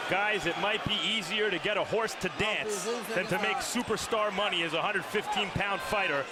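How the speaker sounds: usual speaking voice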